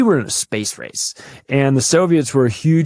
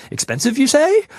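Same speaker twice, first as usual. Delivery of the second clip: much higher mock voice with a rising question; mock high squeak; 14 semitones higher than usual. Host pretends to be surprised about the moon cost, high squeak. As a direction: squeak it like a cartoon character faking surprise.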